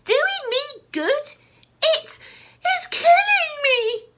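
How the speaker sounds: high, loud rising scream; loud incredulous shriek. A narrator's reading. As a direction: scream the word back. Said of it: "she screamed".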